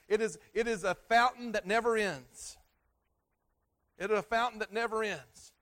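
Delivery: loud, high preaching voice; preaching at full force. Acted. Pastor to congregation, preaching loud and high.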